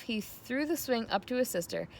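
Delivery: ordinary delivery, nothing marked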